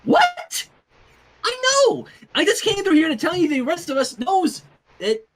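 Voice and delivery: very high, loud hyper pony voice; squeaky high party-pony voice; bubbly Pinkie Pie. Acted. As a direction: bounce in all giddy and loud.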